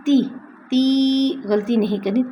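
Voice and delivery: ordinary talk, nothing marked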